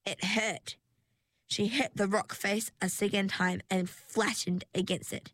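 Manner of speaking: plain reading voice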